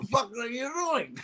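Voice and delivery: high, odd-sounding cursing; Cosby cursing. Retold or imitated. Comic mimics his old boss cursing off camera, high and odd. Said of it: "He's like".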